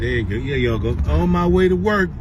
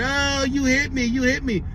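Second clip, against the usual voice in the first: higher imitation of a screaming child; mild mock scream; 4 semitones higher than usual. Man retelling a girl's tantrum, mild mock scream. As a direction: mimic a kid's screamed complaint without really shouting. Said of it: "And she just screaming".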